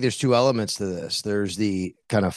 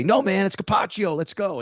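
high energy; 9 semitones higher than usual. Retold or imitated. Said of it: "you gotta bring the energy".

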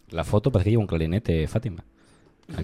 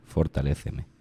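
declaiming a prayer; 4 semitones lower than usual. Acted. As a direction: read the prayer like grand poetry, pausing between lines. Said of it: "voy a declamarlo".

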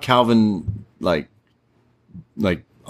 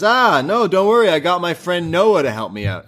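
high, loud, carefree reply; breezy butler; 8 semitones higher, 3 dB louder than usual. Retold or imitated. Grimes shrugging off L A Knight, high and carefree. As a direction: brush off the boss cheerfully. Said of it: "And he says".

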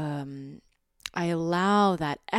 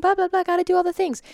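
high, loud, rattled-off rush; overwhelmed to-do babble, high and fast; frantic disembodied voice; 13 semitones higher, 8 dB louder, much faster than usual. Shown as a demonstration. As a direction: race through it in a raised, scattered voice. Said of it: "You can even hear it in my voice".

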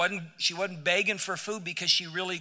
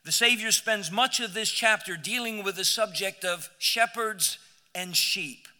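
ordinary delivery

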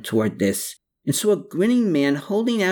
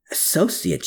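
mildly surprised, higher-pitched query; 6 semitones higher than usual. A narrator's reading. Gertrude hearing of an unknown associate, mildly surprised. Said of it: "she uttered with surprise".

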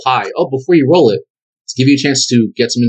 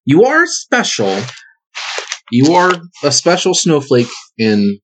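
mock sincere; 3 semitones higher, slower than usual. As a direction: lay the flattery on thick, slowly. GM teasing player's specialness.

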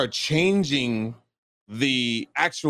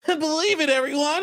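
much higher, hammed-up dub Naruto; 14 semitones higher than usual. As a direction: pitch it way up like a cartoon kid hero. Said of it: "the other person was just like".

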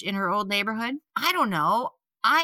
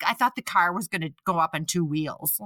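normal talking voice

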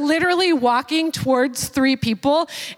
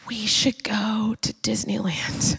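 hushed, conspiratorial whisper; sneaky whispered idea; whispered suggestion; 5 semitones lower, 4 dB quieter, slower than usual. Retold or imitated. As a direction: whisper it like a secret plan. Pastor suggests Disneyland, whispered.

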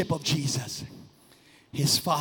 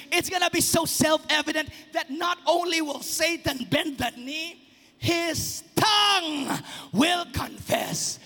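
shouted high over music; soaring sermon shout; full-force preaching; 9 semitones higher than usual. Acted. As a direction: preach it out to the rafters.

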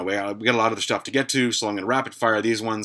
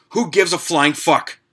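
scornful outburst; 5 semitones higher, 5 dB louder than usual. Real, not acted. Answers the pizza question scornfully.

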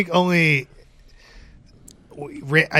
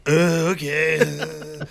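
put-on slow drunk drawl; 5 dB quieter, much slower than usual.

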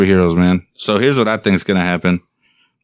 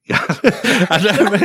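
laughing; 10 semitones higher, much slower than usual. Real, not acted. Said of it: "You gave him an accent in there".

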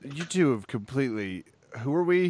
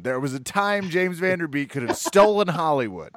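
higher, louder outburst of fan devotion; impassioned defence; 7 semitones higher, 4 dB louder than usual. Real, not acted. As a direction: champion the actor with raised voice and pitch. Mock-passionate defence of Van Der Beek.

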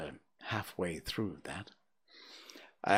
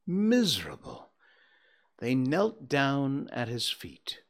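expressive storybook reading voice; story narration; 6 semitones higher, 5 dB louder than usual. A narrator's reading. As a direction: tell it like a fireside tale. Narration resumes.

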